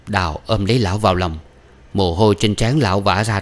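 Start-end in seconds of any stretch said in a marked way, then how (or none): none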